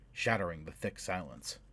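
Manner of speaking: ordinary delivery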